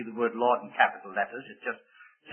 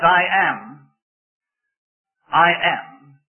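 declaiming God's words; 4 semitones higher, 7 dB louder, much slower than usual. Acted. Voicing God's "I am.

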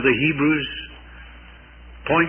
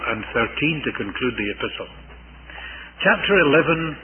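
usual speaking voice

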